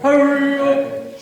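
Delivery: high, slow, loud "hurry up"; mock urgent shout. Speaker egging the premiers on, slow loud mock shout. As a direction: stretch it out like a rally cry. Urging premiers to quit, calling out.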